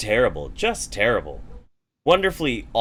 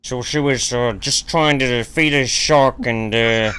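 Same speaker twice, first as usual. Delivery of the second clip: lightly voiced impression with an attempted Australian twang; half-hearted Demotivator voice in a fake Australian accent; 5 dB louder than usual. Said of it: "That's my best demotivator".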